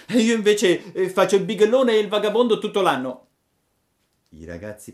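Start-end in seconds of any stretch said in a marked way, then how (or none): 0.1-3.3 put-on high, loud, fast puppet boy; Pinocchio confessing. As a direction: rattle off the confession cheekily. (Pinocchio sfacciato, alto e veloce)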